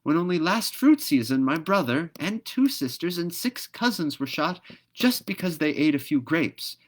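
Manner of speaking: high, loud indignant objection; objecting. Acted. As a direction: protest the unfairness. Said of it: "objected the magpie".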